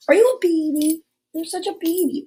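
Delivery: high cooing pet voice. Real, not acted. Baby-talking to the dog.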